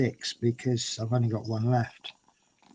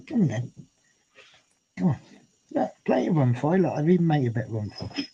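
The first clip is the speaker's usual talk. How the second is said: coaxing the cat; 3 semitones higher than usual. Real, not acted. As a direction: coax the kitten gently over. Coaxing cat onto chair.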